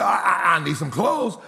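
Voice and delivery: imitated stammer on the first word; stuttering son pleading his case. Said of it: "you know he stuttered".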